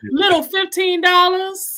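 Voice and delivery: high, loud scoffing question; drawn-out, high-pitched mock incredulity; mock disbelief. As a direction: scoff loudly at the tiny sum. Playing borrower scoffing at small debt.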